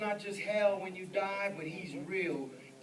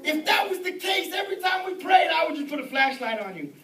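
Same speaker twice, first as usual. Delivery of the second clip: raised, high preacher's voice; forceful preaching; 11 semitones higher, 13 dB louder than usual. Real, not acted. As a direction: drive the absurd point home to the congregation. Preaches mockingly at full voice.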